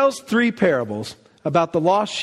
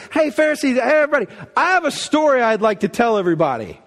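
much higher, loud sing-song call to the crowd; Jesus calling out playfully to the Pharisees; cheeky Jesus; 7 semitones higher, 3 dB louder, faster than usual. Retold or imitated. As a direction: hail the grumblers with mock innocence. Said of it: "He's like".

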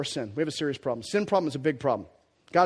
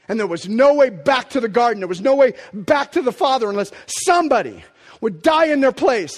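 loud, high, full-force sermon; impassioned preaching; 9 semitones higher, 9 dB louder than usual. Real, not acted. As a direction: preach it with rising fervour.

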